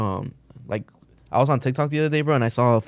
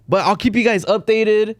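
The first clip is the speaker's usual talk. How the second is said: higher, slow chirpy sign-off; sweet influencer promise; mock vlogger; 8 semitones higher, slower than usual. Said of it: "vlogger voice".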